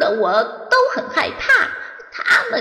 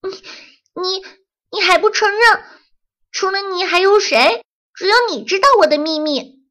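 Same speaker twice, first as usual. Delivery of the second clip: angry; 12 semitones higher, 5 dB louder than usual. Acted. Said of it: "豆豆更生气了".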